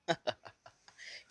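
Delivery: villain's laugh in a story; short, breathy put-on laugh; acted laugh. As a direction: laugh at their mistake. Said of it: "he starts laughing".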